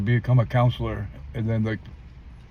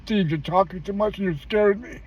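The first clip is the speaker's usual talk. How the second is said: worried wife; 9 semitones higher than usual. Retold or imitated. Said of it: "she says".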